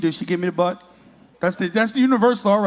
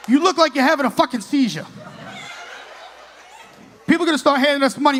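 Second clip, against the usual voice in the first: ordinary delivery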